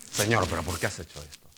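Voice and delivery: a hint of a cranky dwarf grumbling at God; grumpy complaining. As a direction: whine like a grumpy smurf. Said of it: "del pitufo gruñón o el enano cascarrabias".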